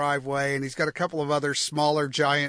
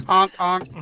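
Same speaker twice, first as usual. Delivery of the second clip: silly clown; 4 semitones higher, 8 dB louder than usual. Pretend. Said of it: "I'm gonna honk".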